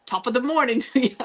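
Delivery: badly faked Irish greeting with a giggle; attempted Irish accent. Shown as a demonstration. Host shows Orla the only Irish phrase, mock Irish accent. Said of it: "I need to study the Irish accent".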